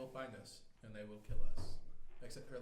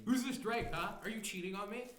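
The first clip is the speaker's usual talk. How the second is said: high, loud mock-jealous accusation; mock jealous lover; 7 semitones higher, 7 dB louder than usual. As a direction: play the suspicious partner.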